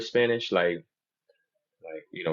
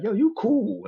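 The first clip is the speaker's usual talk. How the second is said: high friendly voice; 5 dB louder, much slower than usual. Retold or imitated. Imitating friends' approval.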